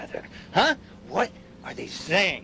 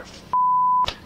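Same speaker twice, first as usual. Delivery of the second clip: very high, slow, stretched cry; drawn-out cry; 5 dB louder than usual. Acted. Declaring we are doomed, in a drawn-out cry. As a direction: hold the cut-off word as a wail. Cut-off curse at climax.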